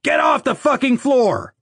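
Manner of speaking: high-pitched furious holler; bellowed angry command, pitch way up; angry yell. A narrator's reading. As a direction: yell an order across the room. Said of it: "someone yelled".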